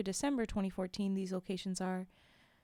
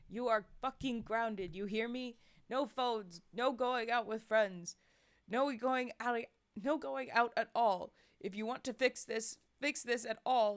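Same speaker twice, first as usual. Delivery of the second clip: angry father; 2 semitones higher than usual. Acted. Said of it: "shouting now".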